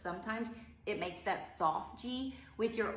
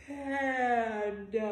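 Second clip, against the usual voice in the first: slow yawned word; panda yawn; 2 semitones higher, 4 dB louder than usual. Shown as a demonstration. Teacher yawning panda along with kids. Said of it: "let's yawn it together".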